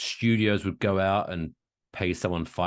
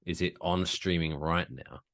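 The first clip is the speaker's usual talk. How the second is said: usual speaking voice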